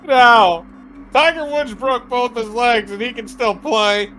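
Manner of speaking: high, protesting wail; loud no, then high insistent protest; outraged denial. Acted. Patient told he'll never play golf, outraged denial.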